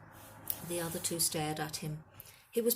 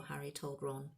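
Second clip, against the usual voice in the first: ordinary delivery, nothing marked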